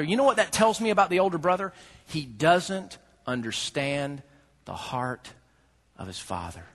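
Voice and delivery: ordinary talk, nothing marked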